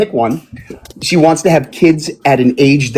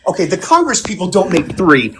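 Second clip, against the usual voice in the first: normal talking voice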